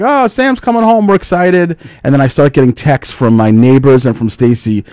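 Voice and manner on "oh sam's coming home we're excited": mock excited. Mocking excited neighbors.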